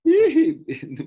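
sudden high burst of laughter; loud laugh. Real, not acted. Teacher laughs at losing student. (carcajada fuerte)

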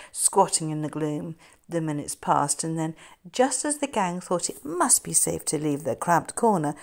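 plain reading voice

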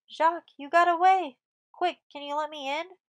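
high, loud urgent exclamation; urgent, panted. A narrator's reading. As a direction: burst out urgently, pleading to be let in. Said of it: "panted Jacques".

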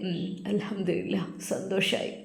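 quietly grieving, content to die; sad. Acted. Father telling son-in-law he can die now, soft and sad. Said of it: "ആ പിതാവ് സങ്കടത്തോടെ".

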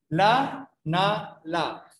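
slow clinical syllable drill; syllables one per second. Shown as a demonstration. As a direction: model the syllables slowly for the patient to copy. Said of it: "una por segundo".

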